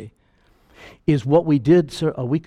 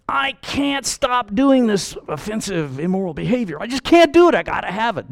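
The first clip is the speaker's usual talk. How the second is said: high, whiny pleading voice; hammed-up desperate plea; mock pleading sinner; 7 semitones higher than usual. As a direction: whine like an addict.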